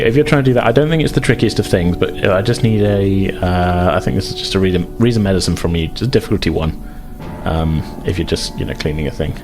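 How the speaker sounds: normal talking voice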